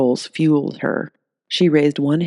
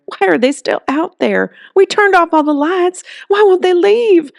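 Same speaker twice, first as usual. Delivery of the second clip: much higher, louder frightened pleading; a terrified mom begging for answers; panicked mother; 5 dB louder than usual. Acted. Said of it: "voice shook with panic".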